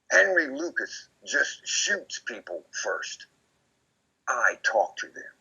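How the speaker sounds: slow, somber confession; lightly voiced Otis. Otis on the porch confiding about Henry, slow and somber. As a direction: let it drop slowly, heavy. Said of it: "finally he said".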